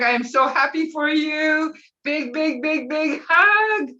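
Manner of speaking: high, happy gush slowing into a drawn-out hug; gushing congratulations. Real, not acted. Congratulating Doctor Derek, real gushing excitement. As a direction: send a big stretched-out verbal hug.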